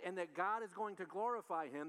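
normal talking voice

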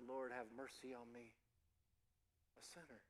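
very soft, low, barely spoken prayer for mercy; broken humble plea. Acted. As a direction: whisper it like a man who can't lift his eyes. Humble tax collector beats breast.

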